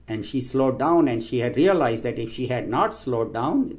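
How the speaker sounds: ordinary talk, nothing marked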